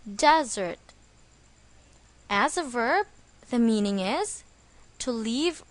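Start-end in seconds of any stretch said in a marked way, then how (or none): 0.1-0.8 "desert" with the stress on its first syllable, DEZ-ert. Shown as a demonstration. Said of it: "The stress is on the first syllable".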